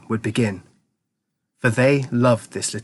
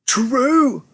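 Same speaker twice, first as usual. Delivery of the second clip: cried out together; 13 semitones higher than usual. A narrator's reading. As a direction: burst out with a high cry. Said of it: "cried all four of them at once".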